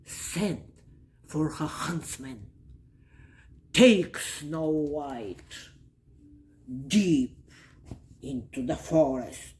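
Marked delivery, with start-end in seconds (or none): 3.7-9.6 low, commanding evil queen, loud on the first words; imperious storybook villain. A narrator's reading. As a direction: order it as the wicked queen. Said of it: "said the evil queen".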